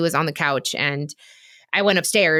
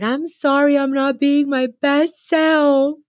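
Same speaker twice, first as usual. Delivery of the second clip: put-on high, tearful little-girl apology; 8 semitones higher, 3 dB louder, slower than usual. Retelling her tearful apology to husband on the couch, high and teary. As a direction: plead sorry like a crying little girl. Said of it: "I started crying like a little girl".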